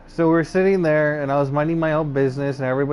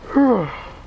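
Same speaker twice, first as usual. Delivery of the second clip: high, breathed-out sigh; 7 semitones higher than usual. Real, not acted. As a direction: let out a big breath. Long pause while recalling.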